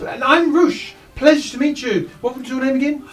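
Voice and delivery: bubbly little gnome introducing himself; chirpy gnome. Acted. Gnome Roosh greets the party eagerly.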